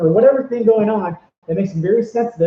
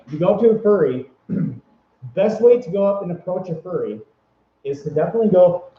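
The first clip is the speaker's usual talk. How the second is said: normal talking voice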